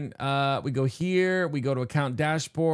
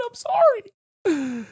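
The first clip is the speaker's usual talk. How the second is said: high, slow, drawn-out sorry with a louder swell; 4 dB louder, much slower than usual. Acted. Streamer hamming up a final apology to chat, high and slow.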